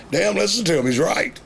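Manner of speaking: high, surprised Damn; a wrestler amazed by the kid. Retold or imitated. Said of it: "one of them speaks up and says".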